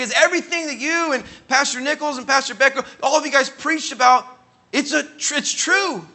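high, loud burst of conviction; impassioned admission. Retold or imitated. Young man admits pastors were right, impassioned. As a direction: build to an astonished cry of belief.

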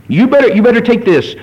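mock threat from the accuser; threatening Satan voice. Preacher imitating Satan scolding a sinner, mock menace. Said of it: "because that's Satan doing that".

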